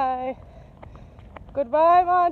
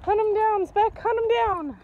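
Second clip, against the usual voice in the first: higher, eager urging; egging on; 7 semitones higher than usual. Real, not acted. Owner playfully urging her dog Speck to chase Monty, higher excited calls.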